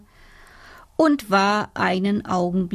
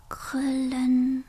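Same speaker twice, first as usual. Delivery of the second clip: whispered; 4 semitones higher, 6 dB quieter than usual. Acted. Soft whispered ending.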